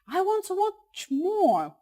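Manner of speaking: put-on high, slow, drawn-out child whine; fighting voice. Shown as a demonstration. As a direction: stretch it out like a protesting kid. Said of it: "they will probably be using their fighting voice".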